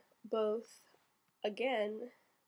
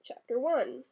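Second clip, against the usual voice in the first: Chapter one projected louder and higher, falling on one; announcing the chapter; 4 semitones higher, 6 dB louder than usual. Real, not acted. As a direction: announce the chapter title like starting a story. Starting the read-aloud passage.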